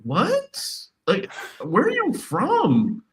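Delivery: high, slow disbelieving question; incredulous. Retold or imitated. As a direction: drag it out in mock disbelief. His own incredulous reply retold.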